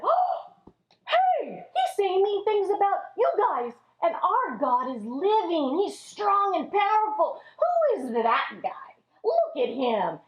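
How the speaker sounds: put-on high, startled young voice; a boy shocked at the insults; David gasps at the taunts; indignant surprise. David reacts to Goliath.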